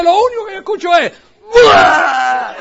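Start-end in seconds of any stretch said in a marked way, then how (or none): 1.5-2.5 loud shouted scream; mock scream. Eddie screaming in the back of the plane, loud howl. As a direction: howl like someone thrilled and scared.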